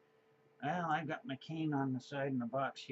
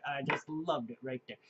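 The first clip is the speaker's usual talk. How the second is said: normal talking voice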